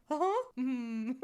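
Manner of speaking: high, loud puzzled noises; hammed-up baffled squeak, then a hum; mock bafflement. Wife lost at father-in-law's accent, mock bafflement. Acting out not understanding father-in-law.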